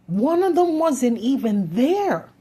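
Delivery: loud, slow, high outburst; raging at the injustice, loud and slow; furious shout. Real, not acted. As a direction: hammer each word out in anger. Angry rant about shooting claim.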